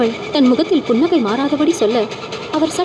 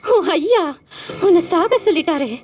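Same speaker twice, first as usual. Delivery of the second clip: much higher, mocking sing-song; smiling spirit; 6 semitones higher than usual. Spirit gleefully telling servant to die, smiling. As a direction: taunt him with a smile. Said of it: "அவள் புன்னகையுடன் சொல்ல".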